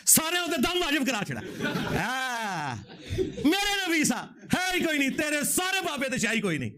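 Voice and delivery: high, laughing ridicule breaking into a shout; laughing scorn. Real, not acted. Preacher laughing at a rival's story, then shouting praise. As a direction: laugh at them, then cry out for the Prophet.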